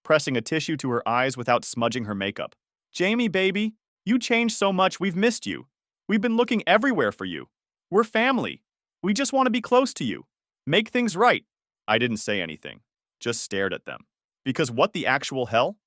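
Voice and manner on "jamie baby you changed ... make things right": high-pitched, put-on tearful pleading; fake crying. A narrator's reading. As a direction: plead in a high voice with phony tears. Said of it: "Linda fake cried".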